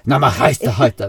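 loud mock Sami gibberish; liksom-samisk filmreplikk. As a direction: imitate indignant Sami film dialogue in nonsense words, loudly. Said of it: "Nå la jeg på dubben oppå". (høylytt tullesamisk etterligning)

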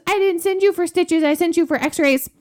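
high, loud indignant scolding; scolding mom. Retold or imitated. Mom scolds dad for the stitches, high and indignant. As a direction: tell him off like an outraged mother.

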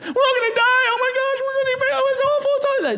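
high, frantic panic, voice way up; panicked fear voice. Acted. Preacher acting out fear in the storm, high panicked wail. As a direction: wail it like everyone's doomed. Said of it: "Fear is irrational in the tempest".

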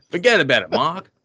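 light New Jersey Italian "forget about it"; hint of a Jersey Italian accent. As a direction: toss it off like a Jersey Italian guy. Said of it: "an Italian on the Jersey coast".

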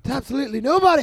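wrestling promo yell. Retold or imitated. Repeated promo quote.

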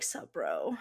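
deep, soft lazy bro greeting; frat bro. Pretend. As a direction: drawl it like a gym bro. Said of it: "he's just like".

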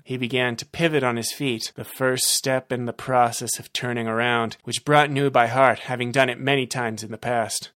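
ordinary delivery

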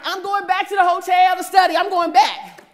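high, loud, laughing protest; wife defending herself to her husband; defensive shout. Retold or imitated. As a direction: shout back that you're going to study.

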